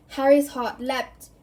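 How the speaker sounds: ordinary delivery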